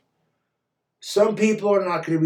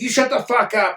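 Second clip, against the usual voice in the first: mock shout; 7 semitones higher, 3 dB louder than usual.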